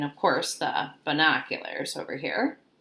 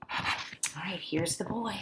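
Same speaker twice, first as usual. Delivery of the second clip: soft hushed aside; whisper; 9 dB quieter, slower than usual. Acted. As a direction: drop to a whisper for the kids. Teacher hushes to show picture.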